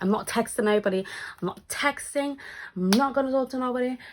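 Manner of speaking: stubborn. Retold or imitated. Annoyed, phone on airplane mode.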